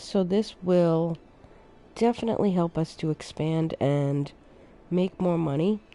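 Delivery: ordinary talk, nothing marked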